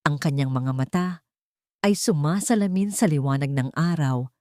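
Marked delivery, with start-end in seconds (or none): none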